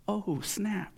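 high, soft onlooker gasp; playful high aside; mock shock. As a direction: toss it in like a scandalized bystander. Repeats onlookers' mock reaction.

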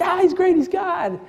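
high-pitched gushing imitation; excited. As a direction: gush like a thrilled fan. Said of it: "kinda really excited".

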